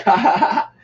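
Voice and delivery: high mock "ha ha" laugh; imitated laugh. Retelling his laugh at the stranger's accent, mock laughing. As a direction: laugh out high, spelled-out ha-has. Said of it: "What's so funny".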